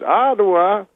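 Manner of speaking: high rising Ottawa; a hint of Cosby; Cosby question. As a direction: echo it back high. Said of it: "He goes".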